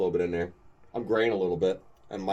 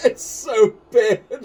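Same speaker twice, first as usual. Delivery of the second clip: laughing; 9 dB louder, much slower than usual. Real, not acted. Laughing at Cage's accent.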